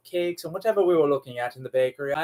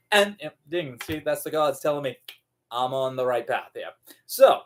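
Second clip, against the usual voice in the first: ordinary delivery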